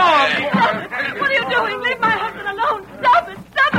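high, desperate cries; frantic pleading. Acted. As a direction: beg them to let him go. Wife as husband is seized.

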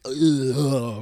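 ordinary delivery

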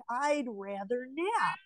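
a mock yawn in the voice; sleepy mock voice. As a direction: play it half asleep, longing for a nap. Sleepy thirties voice, mocked.

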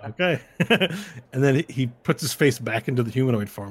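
ordinary delivery

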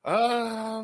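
usual speaking voice

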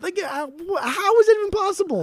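high-pitched incredulous outburst; genuinely baffled, voice shooting way up. Real, not acted. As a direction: squeak it out in real disbelief. Incredulous outburst about the company.

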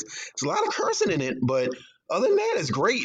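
a hint of a disapproving aunt; aunt's verdict. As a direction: mimic a churchgoing aunt's grudging praise.

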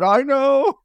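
high, loud mock-baffled reply; awkward bewilderment. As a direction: squeak it out, comically at a loss. Awkward hug from crying stranger, retold.

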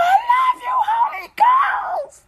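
extremely high, loud crying through laughter; mock tearful wail. Worshipper cries love to the Holy Ghost, hammed-up sob. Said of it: "And you're like".

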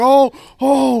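high, loud startled oh; a sudden surprised double oh; startled yelp. Retold or imitated. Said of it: "He was like".

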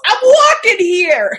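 loud, drawn-out East Coast holler; East Coast accent. Acted. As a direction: shout it like an East Coast pedestrian. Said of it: "East Coast".

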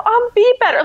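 imitating her own sobbing reply; hammed-up crying voice; mock bawling. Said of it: "and I'm bawling".

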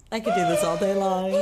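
usual speaking voice

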